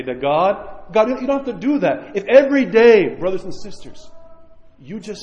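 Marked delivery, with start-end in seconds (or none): none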